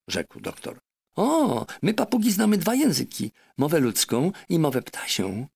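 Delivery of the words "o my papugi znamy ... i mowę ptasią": much higher, proud boastful parrot. Acted. Parrot proudly telling the doctor about bird language. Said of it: "odparła Polinezja dumnie".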